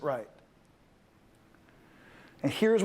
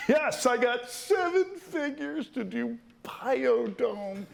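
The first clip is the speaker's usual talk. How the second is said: laughing; 4 semitones higher, slower than usual. Real, not acted. Bragging about his seven figures, laughing.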